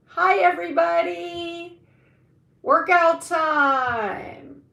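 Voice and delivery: high, loud call to the viewers; cheerful shouted announcement; loud greeting. Real, not acted. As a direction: belt out the hello like a host.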